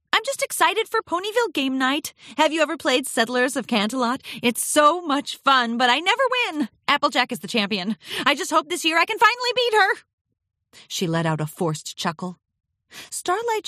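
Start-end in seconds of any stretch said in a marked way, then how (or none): 0.1-10.0 high, rushed, over-excited chatter; fibbing Twilight Sparkle. A narrator's reading. Twilight chatters about game night to hide her worry, over-eager. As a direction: babble brightly to cover up the fib. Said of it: "She was obviously fibbing".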